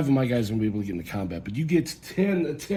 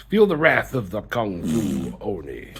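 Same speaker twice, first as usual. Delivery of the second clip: movie trailer voice; 6 semitones lower, slower than usual. Acted. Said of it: "I sound like the movie trailer guy".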